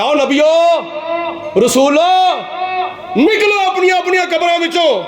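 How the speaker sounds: high, ringing call; calling out a summons. Acted. God summons the prophets from their graves, high calling out.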